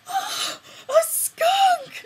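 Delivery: high, loud alarmed cry; teacher shrieking about a skunk; panicked shout. Retold or imitated. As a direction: shriek it like you've seen a wild beast. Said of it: "and then was like".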